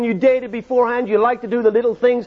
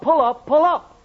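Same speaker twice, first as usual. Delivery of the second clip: a lightly voiced automated alarm, a bit higher; imitated cockpit warning; 4 semitones higher than usual. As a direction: repeat it like a machine alarm from the box. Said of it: "voice came on in English from the box".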